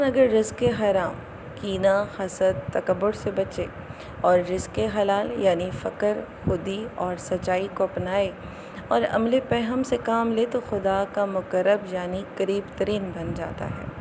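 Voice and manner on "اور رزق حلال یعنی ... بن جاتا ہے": ordinary talk, nothing marked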